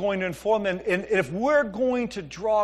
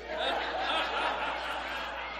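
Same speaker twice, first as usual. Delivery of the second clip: breathy laugh; 8 semitones higher, 12 dB quieter than usual. Real, not acted. Laughs at daughter's prank.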